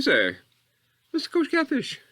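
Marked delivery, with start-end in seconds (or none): 1.1-2.0 lightly put-on high lady voice; imitated lady, high-pitched; lady's voice. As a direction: pitch it up for a mock official lady. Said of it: "She sort of talked like that 'cause she's a lady".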